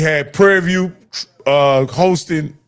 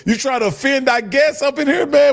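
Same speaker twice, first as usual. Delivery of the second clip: mock offense; 9 semitones higher than usual. Mock offense, teasing colleague.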